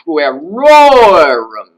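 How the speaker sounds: "puerorum" with a huge loud, high, long "ror"; slow, soaring stress inside "puerorum"; high pitched ending. Shown as a demonstration. Teacher modelling the plural genitive, "puerorum" swooping high and loud. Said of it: "with a higher pitch on the personal ending".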